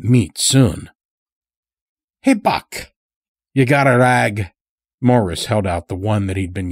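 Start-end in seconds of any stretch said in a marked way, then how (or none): 2.2-4.5 put-on a higher, odd country voice for the old stranger; sly old-timer with an unplaceable accent; Morris's strange accent. A narrator's reading. Chained old stranger talking to the lodge master, in an odd accent. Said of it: "spoke in such a strange way".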